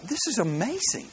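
high, thrilled exclamation; amazed. Acted. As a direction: sound truly blown away by the swim. Millionaire amazed by swimmer.

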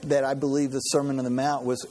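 normal talking voice

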